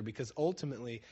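normal talking voice

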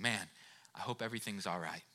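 gently hoping the speeding driver is okay; soft caring dad murmur; calm and gentle. Retold or imitated. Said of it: "my dad very calmly, very gently saying".

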